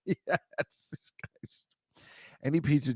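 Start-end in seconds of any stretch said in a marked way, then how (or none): 0.0-1.6 soft, slow whispered aside; whispered approval. Retold or imitated. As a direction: whisper it to yourself while watching. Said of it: "and I'm always like".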